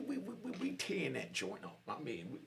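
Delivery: normal talking voice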